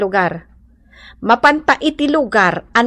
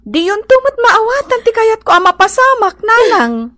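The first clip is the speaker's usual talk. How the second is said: higher pitched urgent plea; pleading; 9 semitones higher than usual. Acted. Daughter pleading higher with her weeping mother. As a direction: push the plea up, insistent.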